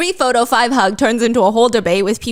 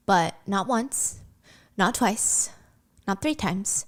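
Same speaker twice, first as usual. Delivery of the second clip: hushed, building suspense; 4 semitones lower, 10 dB quieter, slower than usual. Real, not acted.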